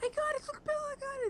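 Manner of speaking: very high, thrilled mimicry; imitating overjoyed daughter. Parent retelling daughter getting her wanted game, high excited imitation. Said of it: "like, so happy".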